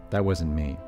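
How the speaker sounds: plain reading voice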